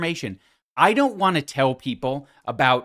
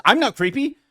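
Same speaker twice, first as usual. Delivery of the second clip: higher, louder whiny mock of her; mocking imitation; 6 semitones higher, 3 dB louder, faster than usual. Host imitating the podcaster's defence, whiny mock. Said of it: "are now bringing it up as".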